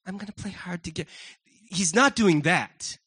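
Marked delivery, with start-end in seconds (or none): 0.1-1.1 put-on quick, soft aside as a coy girl; lightly voiced coy little girl. Preacher mimics a girl playing hard to get, lightly coy. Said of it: "He's not a little girl going".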